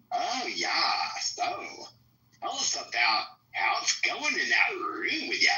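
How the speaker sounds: put-on high, slow valley girl drawl. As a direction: ham up a ditzy valley girl. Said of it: "the Valley Girl ... when they talk. It's like".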